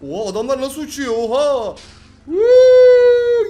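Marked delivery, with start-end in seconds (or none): 2.2-3.5 very high, very loud whoop of joy; thrilled whoop. Real, not acted. Gamer's car flying off a ramp, wild whoop. Car flies through the air.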